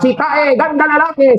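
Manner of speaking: high-pitched boastful call; mock shout. Joke character shouting for an echo, high mock shout. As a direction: call it out high, as if across a valley. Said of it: "Dahil nung sumigaw siyang".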